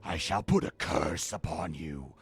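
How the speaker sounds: low, loud villain voice; theatrical, menacing proclamation; curse called out. Acted. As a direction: call down a curse, booming and deep. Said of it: "he calls out".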